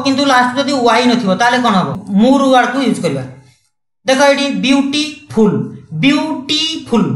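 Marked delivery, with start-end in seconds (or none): 4.6-5.6 ବିୟୁଟିଫୁଲ୍ slowly in three beats, "byu - tii - phul"; stretched, counted-out syllables in ବିୟୁଟିଫୁଲ୍; syllable-by-syllable demo. Shown as a demonstration. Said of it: "ଦୁଇଟାରୁ ଅଧିକା ହଉଛି". 6.0-7.1 ବିୟୁଟିଫୁଲ୍ very slow, higher, as "byuu - tii - phul"; three long, separate beats in ବିୟୁଟିଫୁଲ୍; syllable-by-syllable demo. Shown as a demonstration. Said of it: "ଦୁଇଟାରୁ ଅଧିକା ହଉଛି".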